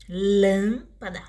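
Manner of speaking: "Lâmpada" slowly, first syllable held and strong; stress on LÂM. Shown as a demonstration. Teacher repeating the stressed example word, stress on LÂM. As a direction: hold "lâm", then drop off on "pada" in "Lâmpada". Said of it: "antepenúltima sílaba mais forte".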